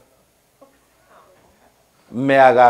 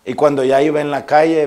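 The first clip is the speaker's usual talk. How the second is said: ordinary talk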